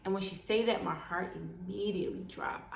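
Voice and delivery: usual speaking voice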